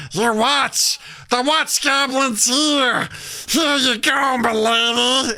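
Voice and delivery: high, slow, hammed-up goblin announcing himself; Watch Goblin. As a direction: strut in squealing like a goblin. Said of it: "this character called the Watch Goblin".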